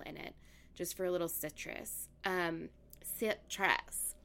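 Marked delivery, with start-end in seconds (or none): none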